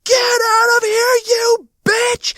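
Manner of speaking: high, drawn-out screaming yell. Retold or imitated. Storyteller re-enacting his panic at the spider, yelling. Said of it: "and I yell".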